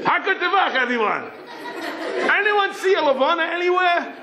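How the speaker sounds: high-pitched excited holler; screaming into radio. Retold or imitated. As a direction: call out to everyone over the radio. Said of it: "and he screams in".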